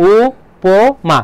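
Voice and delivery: উপমা very slow, 'u... po... ma'; ভেঙে উচ্চারণ. Shown as a demonstration. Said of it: "উচ্চারণ করছি কি হবে বলো". (উপমা ধীরে 'উ-পো-মা')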